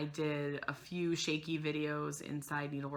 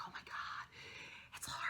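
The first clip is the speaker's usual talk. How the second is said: whisper; 14 dB quieter than usual. Retold or imitated. Starstruck at retreat, seeing Lori.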